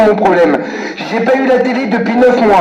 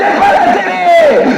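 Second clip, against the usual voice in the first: mocking shout; 10 semitones higher, much slower than usual. Real, not acted. Caller mocking the hold-music ad, slow laughing shout.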